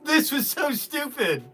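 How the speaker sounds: high, half-sobbing with laughter; laugh-crying. Real, not acted. Seller to chat, tears of laughter. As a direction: pretend to blame them while crying laughing.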